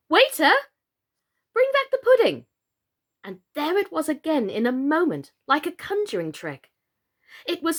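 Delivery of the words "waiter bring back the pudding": high, loud call to the waiter. A narrator's reading. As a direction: call across the room boldly. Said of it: "she called out".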